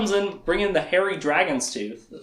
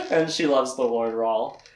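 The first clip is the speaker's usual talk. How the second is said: ordinary delivery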